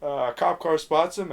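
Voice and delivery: normal talking voice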